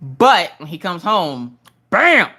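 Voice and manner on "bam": punchy bam. Real, not acted. Punchline when he comes home.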